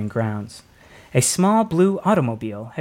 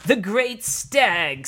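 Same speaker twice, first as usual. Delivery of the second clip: put-on slow, solemn old druid voice, higher; slow elder's proclamation; 7 semitones higher, much slower than usual. A narrator's reading. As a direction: intone it like an ancient elder.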